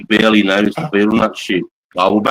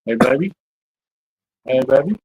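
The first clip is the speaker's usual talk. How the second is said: higher, gentle pet-talk; calming the storm-frightened dog, higher; soothing a pet; 5 semitones higher than usual. Real, not acted.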